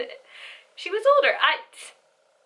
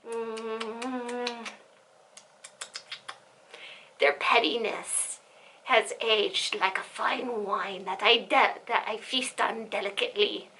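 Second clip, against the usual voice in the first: mock sinister savoring; 3 semitones lower, 7 dB quieter, slower than usual. Mock gourmet savoring revenge.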